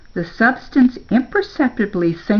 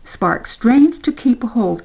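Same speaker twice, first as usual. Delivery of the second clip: usual speaking voice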